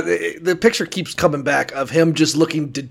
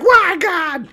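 mock hissy fit; 14 semitones higher than usual. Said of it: "having her little hissy fit".

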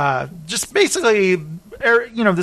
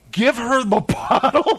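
hammed-up angry plea; mock exasperated; 6 semitones higher than usual. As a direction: imitate fed-up friends, half laughing. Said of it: "They're getting so mad".

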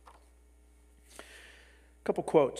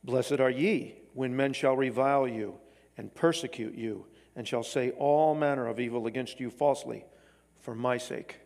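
measured, solemn Bible reading; scripture reading. Acted. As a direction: read the verses out like a pulpit lesson. Reads scripture before the congregation.

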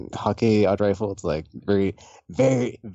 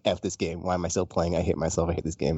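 low, softer mock despair; grumbling self-loathing; 6 semitones lower, 5 dB quieter than usual. Frustrated player muttering about the game, mock self-loathing.

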